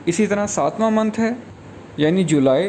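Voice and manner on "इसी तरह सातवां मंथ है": ordinary talk, nothing marked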